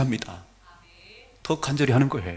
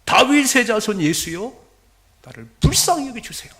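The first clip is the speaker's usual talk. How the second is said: crying out; 6 semitones higher, 7 dB louder than usual. Acted. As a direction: call over a crowd, begging for mercy. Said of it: "안 보이니까 막 소리". (큰 소리로 애타게 부르짖듯)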